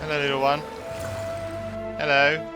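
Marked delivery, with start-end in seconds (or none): none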